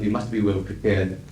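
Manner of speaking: ordinary talk, nothing marked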